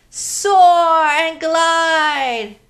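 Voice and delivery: long swooping sing-song; slow gliding cue. Shown as a demonstration. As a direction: draw each word out like soaring. Leading kids' movement game.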